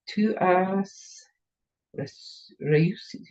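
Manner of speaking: soft, halting French reading; French accent. Real, not acted. Crafter puzzling out the card's phrase, French accent. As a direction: sound out the French quietly, in pieces. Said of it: "Still making it in French".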